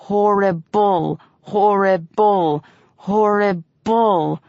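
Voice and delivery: each "horrible" slow in three syllables, stress on the first and last; a slow, despairing repeat of "horrible"; emphatic horrible. A narrator's reading. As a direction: drag out every "horrible", hitting both ends. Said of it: "making the word an emphatic three syllables with fore and aft stresses".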